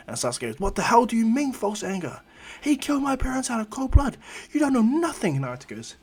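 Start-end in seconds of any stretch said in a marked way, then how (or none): 0.6-5.4 high-pitched, indignant protest; angry outburst. Acted. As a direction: pitch shoots up in outraged disbelief. Sasuke confronts Naruto angrily.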